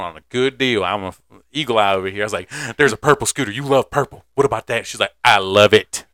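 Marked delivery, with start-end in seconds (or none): 2.8-4.9 excited pitch. Retold or imitated. Pitching a scooter to the child. 5.2-6.1 put-on loud delighted kid's reply; imitating a thrilled child.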